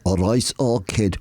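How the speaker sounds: put-on slow Liverpool greeting in an Indian accent; drawn-out mock Indian-Liverpool accent; Indian-accented Scouse. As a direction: imitate an Indian trying Scouse. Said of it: "with an Indian accent?".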